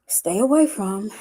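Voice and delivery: normal talking voice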